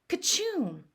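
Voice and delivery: high hammed-up fake sneezes; mock sneezes. Joe fakes Billy's fit, hammed-up sneezes. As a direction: sneeze hard, twice.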